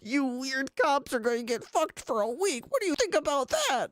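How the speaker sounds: voz aguda de troll burlón; mocking troll. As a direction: imita al troll con tono alto. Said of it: "What the fuck was that guy?".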